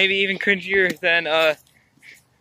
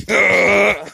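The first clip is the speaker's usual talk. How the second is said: loud frustrated groan; exasperated groan; 4 semitones higher, 6 dB louder than usual. Retold or imitated. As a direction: grunt it loudly. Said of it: "I'm like".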